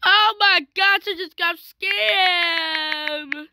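loud, high wail, half crying; outraged whining shout; upset wail. Real, not acted. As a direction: cry out that you got scammed, loud and high. Got scammed in game, wailing.

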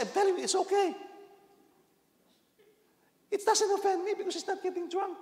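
high, loud insistence that it's fine; insistent shout. Retold or imitated. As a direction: shout down his worry. Reassuring church member about beer.

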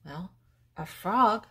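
normal talking voice

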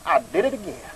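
ordinary talk

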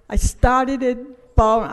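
normal talking voice

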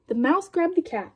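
usual speaking voice

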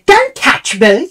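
high, slow mock shriek; hammed-up offended woman; mocking her. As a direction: drag it out in her shrill voice. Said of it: "she goes".

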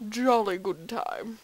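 awful attempt at a Scottish accent; badly faked Scottish film-star accent; Sean Connery. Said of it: "like, a Sean Connery sort of accent".

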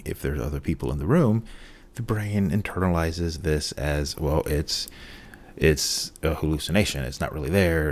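usual speaking voice

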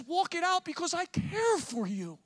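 slow, gentle father's plea; tender loving father. Acted. A lightly voiced loving father encouraging his child, slow and tender. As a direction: say it like a heartbroken dad. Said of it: "voice of a loving father with a broken heart".

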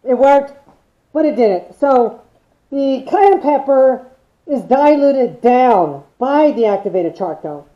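muffled behind face mask; speaking through a respirator. Real, not acted. Seen 0: dual-cartridge respirator worn over mouth throughout labelled speech.

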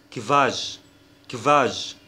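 كيفاج, qu pronounced like k plus v. Shown as a demonstration. Teacher showing German qu, kv at the start of كيفاج. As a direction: say كيفاج opening with a k-v cluster. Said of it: "كأنه كي في".

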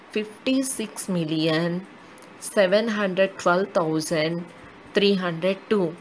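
slow, with a pause after each period; a teacher repeating the number slowly for children. Shown as a demonstration. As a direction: read it slowly, one group at a time. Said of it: "slowly according to their periods".

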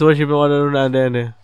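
ordinary talk, nothing marked

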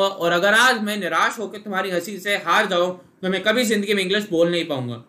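usual speaking voice

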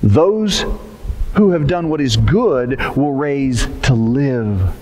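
slow, low solemn reading; scripture reading. Acted. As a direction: read the verse gravely, unhurried.

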